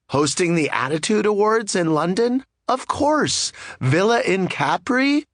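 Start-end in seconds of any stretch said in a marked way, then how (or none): 2.7-3.5 pitched up high, bright and cheerful; playful eager yes. Real, not acted. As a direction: toss it off like a delighted yes. Eager yes to every invitation.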